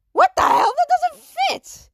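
loud, very high yelp of disbelief; outraged outburst. Acted. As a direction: explode in squeaky protest. Teacher balks at long name.

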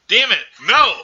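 frustrated outburst. Real, not acted. Podcaster realizing she fell for the Harry Potter joke, loud. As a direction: blurt it out, annoyed at yourself. Caught agreeing to wrong name.

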